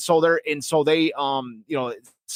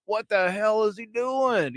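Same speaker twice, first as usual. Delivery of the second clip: slow, higher exasperated quote; mock disbelief; 5 semitones higher, slower than usual. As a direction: drag it out as an appalled pundit. Acting out the appalled media.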